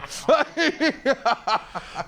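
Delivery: high, trailing laugh that falls away; long real laugh. Real, not acted. Guest laughing at his own hotel joke. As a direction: let a real laugh roll out and fade.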